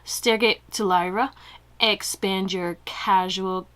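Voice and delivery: slow, drawn-out, dreamy reading voice; slow mystical voice. Real, not acted. Tarot reader reading a card to viewers, in a slow mystical voice. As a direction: stretch each word like an oracle. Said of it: "I don't know why I'm talking like this".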